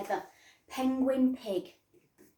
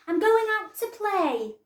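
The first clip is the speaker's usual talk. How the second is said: calling out; 10 semitones higher, 8 dB louder than usual. Acted. Girl calling out to parents.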